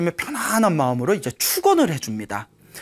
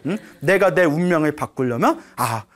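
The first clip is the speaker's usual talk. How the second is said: ordinary talk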